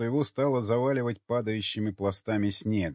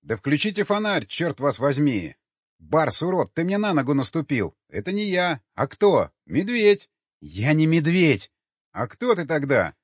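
raised, higher-pitched agitated shouting; panicked squabbling; 4 semitones higher, 5 dB louder than usual. Acted. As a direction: bicker loudly in a confused scramble. Panicked shouting match in the dark. (крикливая перебранка в панике)

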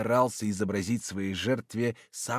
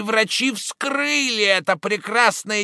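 shouting horror; 12 semitones higher, 8 dB louder than usual. Acted. Roden's shouted horror reveal.

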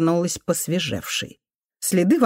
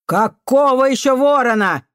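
loud, higher, incredulous question; angry disbelief; 7 semitones higher, 5 dB louder than usual. A narrator's reading. Janitor woman questioning the boy's excuse, angry. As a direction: demand it angrily.

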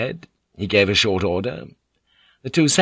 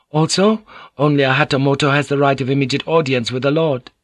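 higher, formal interpreter voice; Mariko, explanatory; 5 semitones higher than usual. Acted. Mariko translates Omi's answer for Blackthorn, higher and formal. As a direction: explain with courteous certainty, pitched up.

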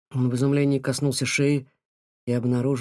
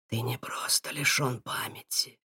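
put-on eerie whispering old seer; a ghostly, breathless whisper; otherworldly voice; 7 dB quieter than usual. A narrator's reading. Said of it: "потусторонним голосом заговорил Нухим".

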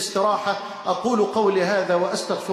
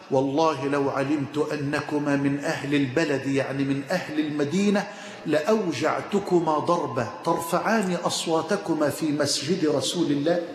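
stern Umar; 7 semitones lower than usual. Retold or imitated. Umar threatens men in mosque.

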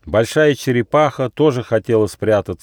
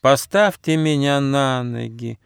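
high, drawn-out plea; pleading turtle; 5 semitones higher than usual. A narrator's reading. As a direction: beg slowly in a turtle's voice. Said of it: "попросила черепаха".

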